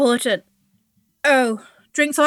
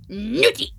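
laughing naughty; 6 semitones higher than usual. Acted. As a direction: giggle through naughty. Same word, laughing.

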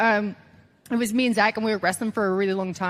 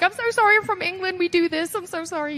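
high-pitched, gushing apology; flustered apology; 9 semitones higher than usual. Retold or imitated. English rookie apologizing for cussing, high and flustered. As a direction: babble sorry way up high.

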